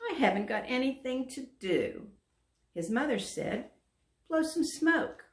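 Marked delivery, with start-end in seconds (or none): 0.1-2.1 put-on lightly forlorn, higher child voice; a little dragon moping to his mother. A narrator's reading. Little dragon complaining to his mother, forlorn. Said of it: "in a forlorn voice". 4.3-5.2 mother voice. Acted.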